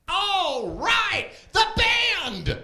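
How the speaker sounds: loud and high; hyped call-out. Real, not acted. Comic hyping the band to the crowd, loud and high. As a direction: shout it to the room.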